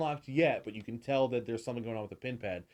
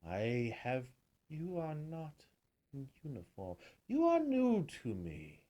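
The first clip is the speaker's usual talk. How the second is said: regal bee queen; 6 dB quieter than usual. Acted.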